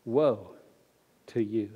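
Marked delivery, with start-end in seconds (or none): 1.3-1.7 low, soft, grave reading of the woes; solemn scripture reading. Acted. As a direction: read the woes like a hushed warning. Reads scripture passage.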